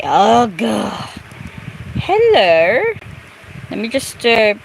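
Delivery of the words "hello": high lilting hello; high sing-song hello. Pretend. Greeting in a high voice.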